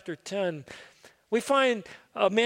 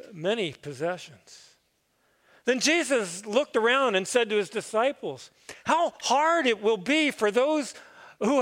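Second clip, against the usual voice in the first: raised, solemn gospel reading for the congregation; scripture reading; 2 semitones higher than usual. Acted. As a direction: proclaim the text from the pulpit, measured. Pastor reads the gospel lesson.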